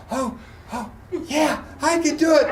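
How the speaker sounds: panting runner perking up, high-pitched; rally from gasps into eager resolve; acted renewed energy. Retold runner revived by cheers.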